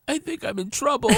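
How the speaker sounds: imitating her in a high small voice; lightly voiced wife in trouble; high mock distress. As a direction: squeak it out like a worried wife. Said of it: "and she says".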